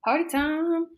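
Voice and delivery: high, drawn-out party call; playful cheer. Retold or imitated. Student pitching a California trip to a friend, playful cheer.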